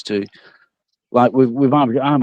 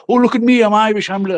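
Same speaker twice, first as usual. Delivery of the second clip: high-pitched, louder mock show-off; mock boasting; 10 semitones higher, 3 dB louder than usual. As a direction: put on a high, attention-seeking brag. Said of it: "I'm not like a".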